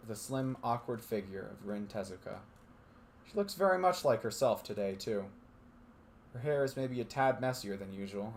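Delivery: steady narrator reading a visual novel; story narration. A narrator's reading. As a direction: read it like a storyteller.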